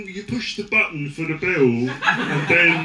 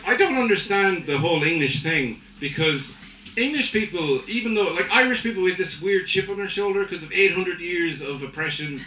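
ordinary talk, nothing marked

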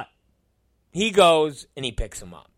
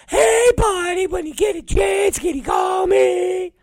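very high, loud squeaky mimicry of a needy buddy; mock him in a shouted falsetto; mocking caller impression; 4 dB louder than usual. Coworker pestering his boss to call him, high mocking falsetto.

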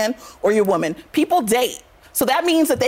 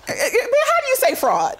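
laughing mid-mock; 11 semitones higher, much slower than usual. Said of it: "She's like".

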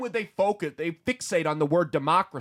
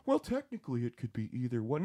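softer, lower mock pundit voice; mocking mimicry; 4 semitones lower, 6 dB quieter than usual. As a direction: imitate a waffling pundit dismissively. Mimicking the waffling pundits he mocks.

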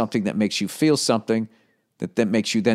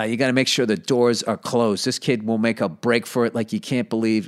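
usual speaking voice

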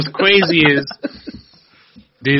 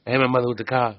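low mumbled retelling of the lyric; 4 semitones lower, 8 dB quieter, faster than usual. Retold or imitated. Friends joking about a [name] lyric, mumbled mock quote. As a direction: mumble it like the rapper. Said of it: "He said that".

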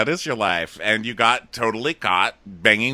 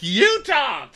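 high-pitched YOU thrown back at him; mock indignant retort. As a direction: imitate her snapping back in a high voice. Retold quarrel, her retort.

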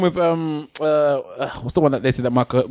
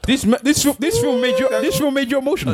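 high-pitched, disbelieving challenge; incredulous teasing; 10 semitones higher than usual. Real, not acted. Ribbing a friend who got emotional at a film, incredulous. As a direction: pitch it way up, can't believe he admits it.

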